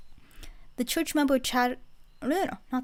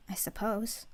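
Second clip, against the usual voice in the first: soft whisper; 5 dB quieter than usual. Acted. Softly trailing off.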